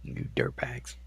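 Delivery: low whispered insult; mock growl. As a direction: hiss it in mock scorn.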